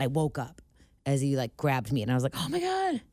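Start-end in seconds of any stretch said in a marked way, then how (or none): 2.4-3.0 high breathy startled Oh my God; startled gasp. Retold or imitated. Friend retelling a nightmare, startled and high. Waking from a scary dream.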